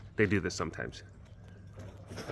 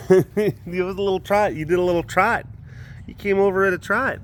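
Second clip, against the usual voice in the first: delighted; 9 semitones higher, 5 dB louder than usual. Real, not acted. Delighted at cat trotting.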